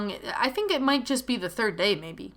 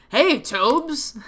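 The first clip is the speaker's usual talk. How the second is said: a hint of a cheerful older brother greeting; lightly voiced, cheerful call to his sibling; cheerful big brother; 5 semitones higher, 4 dB louder than usual. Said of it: "A cheerful, deep voice yelled".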